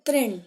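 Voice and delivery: "तृण" loud and distinct as "trin"; word reading drill. Shown as a demonstration. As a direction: model "तृण" with a clear "tri". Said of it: "क्या पढ़ा मैंने उसे?".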